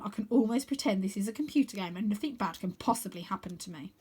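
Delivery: ordinary delivery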